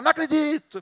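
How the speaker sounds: high, loud mock amazement; acted disbelief. Onlooker reacting to a mountain moving, acted disbelief. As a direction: exclaim it like a stunned onlooker. Imagined witness of a miracle.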